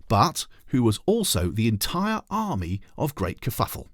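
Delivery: usual speaking voice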